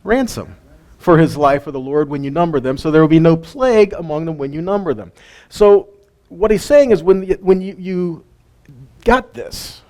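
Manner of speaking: ordinary talk, nothing marked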